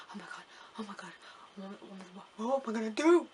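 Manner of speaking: fast, breathless whispered panic; panicked whisper. Retold or imitated. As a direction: whisper it like nerves at security. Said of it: "I just, like".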